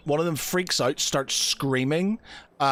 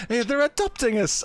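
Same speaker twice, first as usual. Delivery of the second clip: put-on high, eager child voice; excited kid; 8 semitones higher than usual. Kid confirms the adoption to the other children, excited. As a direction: chirp it like an overjoyed kid. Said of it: "his, his eyes light up".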